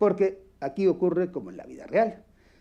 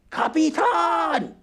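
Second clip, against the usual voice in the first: drawn-out call, high and a little louder, without really shouting; fake radio shout; 13 semitones higher, 5 dB louder, much slower than usual. Shown as a demonstration. Teacher demos a radio shout that is not a real yell. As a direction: call the captain so it only seems a yell. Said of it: "no gritas, parece que gritas".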